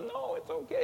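high, tearful consoling voice; crying comfort. Retold or imitated. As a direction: comfort him through tears. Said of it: "and we're crying".

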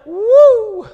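high, loud whoop of joy; joyful whoop. Real, not acted. Preacher whooping with joy before the congregation. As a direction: let out a happy whoop.

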